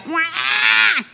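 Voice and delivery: high, drawn-out "wah"; imitated baby wail. Preacher showing a hungry baby crying, mock wail. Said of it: "a little baby".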